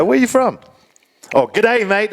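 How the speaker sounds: high hammed-up Australian accent; mock Aussie accent. Locals greeting a visitor, mock Australian accent. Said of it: "they go".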